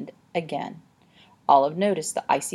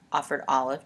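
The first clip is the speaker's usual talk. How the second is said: ordinary delivery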